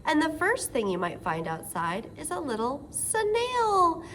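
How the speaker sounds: ordinary talk, nothing marked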